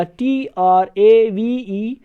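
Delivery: slow letter-by-letter dictation; slow teacher spelling. Shown as a demonstration. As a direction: spell each letter slowly. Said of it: "British English में लिखोगे".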